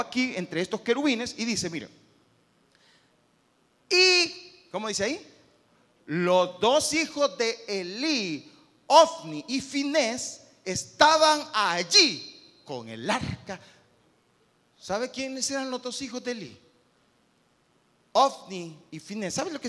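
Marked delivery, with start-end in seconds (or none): 6.1-13.6 each word slow and loud; slow emphatic reading. Acted. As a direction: read it out weighty and slow. Reading scripture slowly and loudly. (lectura lenta y enfática)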